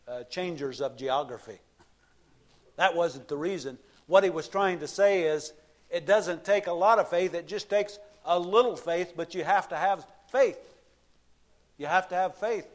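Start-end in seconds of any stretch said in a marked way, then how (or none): 4.1-12.7 raised, loud preaching voice; fervent sermon climax; preaching at full force. Acted. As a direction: drive the point home from the pulpit. Preaching passage raised pitch.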